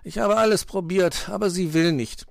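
high, resigned protest. A narrator's reading. Fox tells friends she refuses, resigned. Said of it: "sagte Herr Fuchs resigniert".

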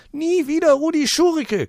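very high, loud crowing shriek. Acted. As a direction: crow the slogan again. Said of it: "kräht". (krähend)

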